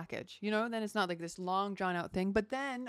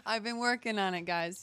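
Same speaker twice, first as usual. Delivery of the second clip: usual speaking voice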